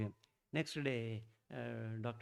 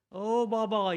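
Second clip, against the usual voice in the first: high, loud cry of "Oh, Baba"; shouting to Baba; 9 semitones higher, 11 dB louder, slower than usual. Retold or imitated. Old patient cries out to Baba, a loud high shout. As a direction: call out to the saint with all your voice. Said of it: "he is shouting".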